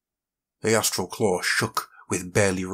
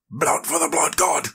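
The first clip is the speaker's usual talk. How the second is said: higher roaring war chant; mob roar; 7 semitones higher than usual. Acted. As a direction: roar it like a crazed crowd. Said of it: "the mob roared".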